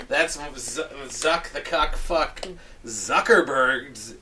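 ordinary delivery

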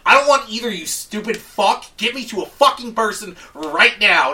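loud, high furious shout at a robot; angry yell. Retold or imitated. Caller losing it at a robot menu, shouting. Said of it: "I'm like".

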